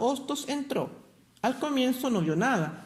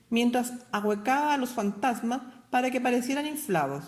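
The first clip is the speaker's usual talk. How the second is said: ordinary delivery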